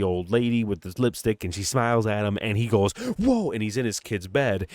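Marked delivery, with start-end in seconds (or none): 3.2-3.5 high, loud startled whoa; imitating freaking out. Host retells the father seeing the crazy old lady, startled yelp. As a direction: yelp it like a man jolted by a nightmare. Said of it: "he's, like, fucking freaking out".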